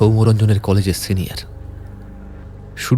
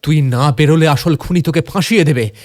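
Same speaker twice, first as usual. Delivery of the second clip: urgent warning; 7 semitones higher, 5 dB louder than usual. Acted. Urgent warning to jailed friend.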